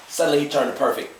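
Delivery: usual speaking voice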